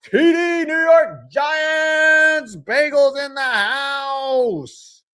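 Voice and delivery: slow, high, drawn-out announcer hype; stretched-out arena hype call; hype intro. Acted. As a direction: belt it like a hype man.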